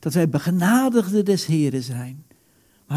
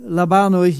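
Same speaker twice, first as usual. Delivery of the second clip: ordinary delivery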